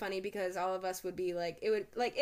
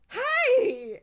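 much higher, much louder gleeful hi; drunk cheery hi; 14 semitones higher, 10 dB louder than usual. Retold or imitated. Drunk friend Bailey greeting her roommates, squealed high and loud. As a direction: burst out with a tipsy, giddy greeting.

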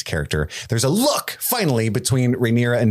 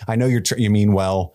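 ordinary talk, nothing marked

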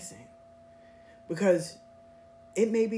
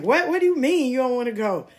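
fast, louder, higher outraged question; quick, raised-pitch indignant what; indignant disbelief; 5 semitones higher, 6 dB louder, faster than usual. Retold or imitated. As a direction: demand an explanation in disbelief. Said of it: "I was really in my feelings".

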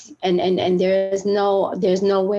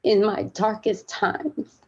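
choked up; 3 semitones higher than usual. Real, not acted. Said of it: "getting choked up talking about this".

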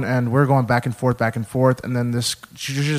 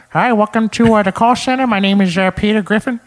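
high, loud cartoon dad voice; Peter Griffin; 8 semitones higher, 5 dB louder than usual. Shown as a demonstration. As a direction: greet callers as a goofy cartoon dad. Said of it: "Family Guy voices".

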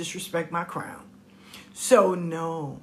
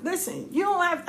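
emphatic advice; 10 semitones higher than usual. Retold or imitated. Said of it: "I always tell them".

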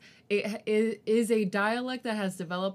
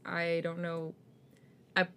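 ordinary talk

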